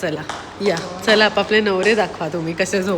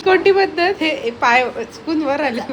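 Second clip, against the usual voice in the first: loud laughing tease; laughing teasing; 4 semitones higher, 6 dB louder than usual. Real, not acted. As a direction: tease him through laughter.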